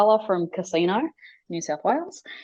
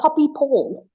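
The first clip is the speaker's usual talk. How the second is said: put-on higher child voice, proud; toddler naming; 8 semitones higher, 3 dB louder, slower than usual. Toddler naming the ghost to mum, high proud child voice. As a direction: say the name like a small kid. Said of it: "she's like".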